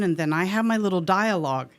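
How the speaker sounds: ordinary delivery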